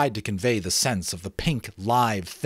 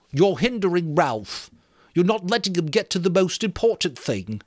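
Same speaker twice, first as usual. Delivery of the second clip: put-on higher, louder fussy boy's voice; Piggy tells Jack he's getting in Ralph's way, high and insistent; 10 semitones higher, 5 dB louder than usual. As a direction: scold them for interrupting.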